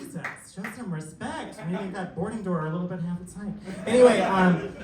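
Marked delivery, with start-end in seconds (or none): 0.0-3.5 much softer, half-whispered scolding; muttered aside. Real, not acted. Comic scolding a quiet crowd under his breath, hushed. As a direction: mutter it to yourself.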